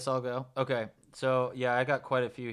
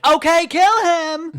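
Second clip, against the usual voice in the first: much higher and louder, slow and dopey; Harry impression; 11 dB louder, slower than usual. Harry giving up his friend, in a slow high squeal. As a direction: stretch it out in a high dim-witted squeal. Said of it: "he's like".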